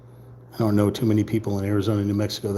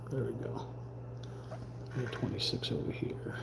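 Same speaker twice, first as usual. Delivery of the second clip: whispered under the breath; 17 dB quieter than usual. Real, not acted. Muttering to self over the puzzle, whispered. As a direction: whisper it to yourself.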